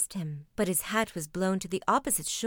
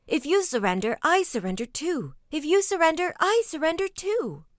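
loud panicked cries, climbing much higher on the repeat; crying out; 8 semitones higher, 6 dB louder than usual. A narrator's reading. Six comrades piling up in surrender, crying out. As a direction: cry out your surrender, building in pitch.

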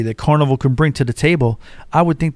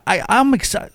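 ordinary talk

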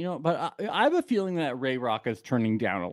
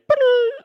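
high-pitched excited squeal; 7 dB louder than usual. Retold or imitated. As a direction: squeal like a crowd of kids. High squeal imitating crowd.

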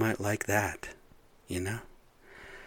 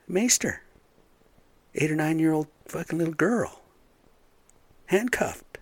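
high, pitched-up disbelief; outraged; 7 semitones higher than usual. Real, not acted. Ranting to viewers about a little girl maced, furious and high. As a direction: spit out each word in real outrage.

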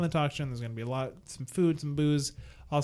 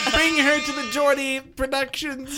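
excited; 10 semitones higher, 8 dB louder, slower than usual. Real, not acted. Eagerly urging friend to bring mom.

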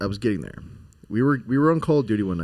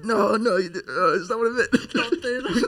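laughing; 12 semitones higher, much slower than usual. Retold or imitated. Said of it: "And he's like".

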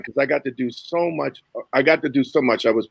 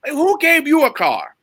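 mock angry yell; 13 semitones higher, slower than usual. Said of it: "Jerry going off of me".

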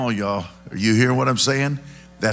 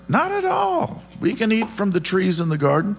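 high-pitched woman's voice; Eve; 9 semitones higher than usual. Acted. Woman answering the serpent, high-pitched voice. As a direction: start very high, easing down as she explains. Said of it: "the woman said to the serpent".

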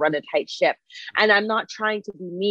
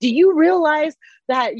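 higher, louder and slower, a retold scolding yell; angry yell; 9 semitones higher, 6 dB louder than usual. Janet scolding the stunt guys for the danger, slow angry yell. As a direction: drag the words out as you lose it on them.

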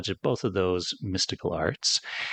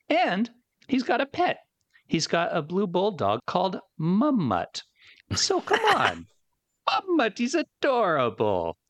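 excited; 7 semitones higher than usual. Real, not acted. Gushing about pet bulldog.

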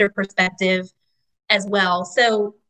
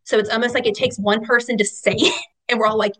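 ordinary talk

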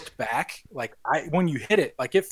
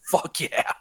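bird voice whisper. Retold or imitated. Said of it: "the birds are like".

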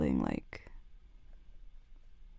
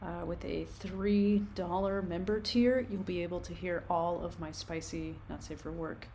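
ad read; 4 dB quieter than usual. Real, not acted. Patreon outro read.

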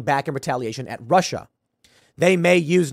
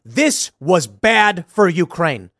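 slow mock spokesperson; 2 semitones higher, 4 dB louder, much slower than usual.

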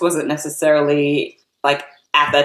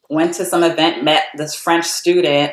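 usual speaking voice

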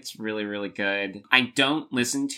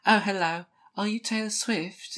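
high put-on British boyfriend voice; mock Joe voice; 11 semitones higher than usual. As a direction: ham up a charming British guy. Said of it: "That's not what he sounds like".

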